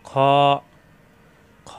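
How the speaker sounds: খ rasped from the end of the throat; heavy guttural fricative on খ; back-of-throat kh. Shown as a demonstration. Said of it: "কণ্ঠনালীর শেষ অংশ থেকে উচ্চারণ".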